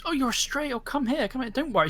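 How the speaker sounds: high, gentle coaxing voice; coaxing a stray. Acted. Girl coaxing a stray wolf, high and gentle. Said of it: "she, like, goes".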